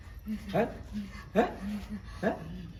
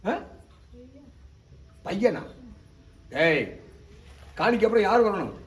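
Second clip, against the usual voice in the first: usual speaking voice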